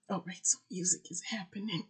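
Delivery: excited hushed whisper. Real, not acted. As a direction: whisper it like a secret. Aside about new music.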